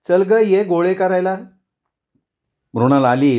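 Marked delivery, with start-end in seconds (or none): 0.1-1.4 higher-pitched mild call. A narrator's reading. Rekha summoning Mrunal to shape the balls, calling out. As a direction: call her over, without really shouting. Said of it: "मृणालला आवाज दिला".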